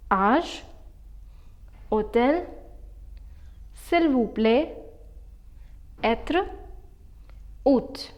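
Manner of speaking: Âge, hôtel, s'il vous plaît, être, hôte, each said singly in French; pronunciation demo. Shown as a demonstration. Teacher reading circumflex words to learners, careful French pronunciation. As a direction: model each French word with silent circumflex, pauses between. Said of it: "Let me pronounce all of these for you".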